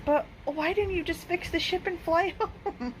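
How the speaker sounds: a high, disbelieving plea; astonished question. Acted. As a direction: ask it gobsmacked. Narrator's astonished question in book.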